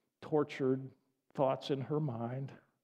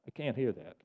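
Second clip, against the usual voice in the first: normal talking voice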